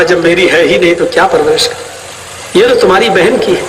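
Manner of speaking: husband answering his wife; husband dismissive. Retold or imitated. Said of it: "کہا،". (شوہر کا نیچی تیز آواز میں ٹالنا)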